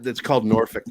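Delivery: Norfolk said the Virginian way, ending in "fick"; local pronunciation. Shown as a demonstration. Local explains the town's name, Norfolk pronounced "NOR-fick". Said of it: "Most people call it Norfolk".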